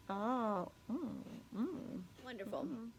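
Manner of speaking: munching while talking; chewing. Real, not acted. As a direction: mouth full. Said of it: "see me chewing".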